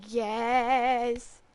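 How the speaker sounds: loud, drawn-out elated shout; excited cheer. Real, not acted. Excited outburst about a favourite character.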